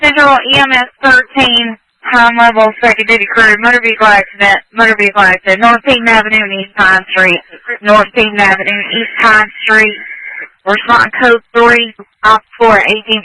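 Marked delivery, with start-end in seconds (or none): none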